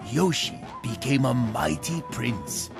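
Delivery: ordinary delivery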